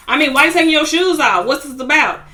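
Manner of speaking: imitating a loud, fast, indignant questioner; mock indignant. Nikki confronting Suki over shoes.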